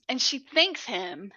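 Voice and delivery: ordinary talk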